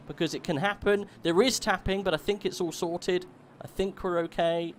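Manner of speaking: high, loud race commentary; excited motorsport commentator at full flow; live play-by-play. Real, not acted. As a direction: call the race start with rising urgency.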